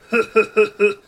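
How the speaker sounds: hero chuckle. Acted. Hero pleased with his pun.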